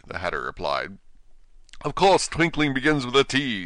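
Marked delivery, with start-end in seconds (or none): 1.8-3.6 sharp. Acted. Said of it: "said the king sharply".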